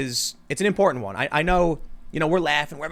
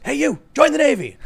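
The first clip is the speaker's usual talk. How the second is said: loud, high shout at a passer-by; acted cartoon yell, much higher and louder; yelled command; 12 semitones higher, 7 dB louder than usual. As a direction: holler it as if out a window. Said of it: "he just yells it out".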